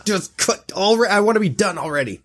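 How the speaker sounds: high, put-on voice of a fed-up wife; mock bored wife; impatient wife. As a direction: whine it like she wants it over. Voicing impatient wife mid-sex.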